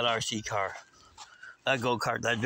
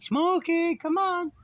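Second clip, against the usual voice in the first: high, louder coaxing holler; calling the dog; 4 dB louder, slower than usual. Retold or imitated. Owner calls his scared dog out, high holler.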